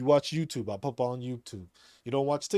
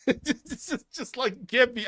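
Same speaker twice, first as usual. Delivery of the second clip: laughing; 10 semitones higher, 4 dB louder than usual. Real, not acted. Laughing over the point.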